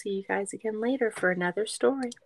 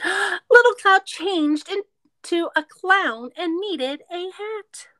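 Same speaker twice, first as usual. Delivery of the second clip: surprised; 10 semitones higher, 5 dB louder, much slower than usual. Acted. Gasp before clown reveal.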